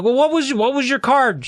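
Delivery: high, exasperated demand; losing patience mid-trick; exasperated yell. Retold or imitated. Brady snaps at his sister Susie in exasperation.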